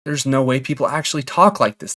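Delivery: ordinary delivery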